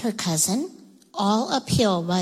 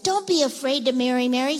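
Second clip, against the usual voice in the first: fast tongue twister; 4 semitones higher, 4 dB louder than usual. Shown as a demonstration. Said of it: "Say that fast".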